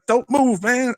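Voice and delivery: high, pleading mock voice; drunk old guy. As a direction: squeak it like a drunk hiding behind you. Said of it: "He's like".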